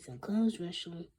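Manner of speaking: plain reading voice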